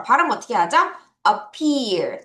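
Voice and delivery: normal talking voice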